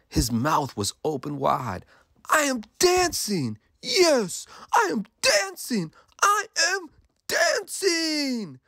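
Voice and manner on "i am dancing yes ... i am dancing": very high, exultant exclamations; overjoyed cry. A narrator's reading. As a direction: cry out in amazed delight, stretching the last one. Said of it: "Gerald cried".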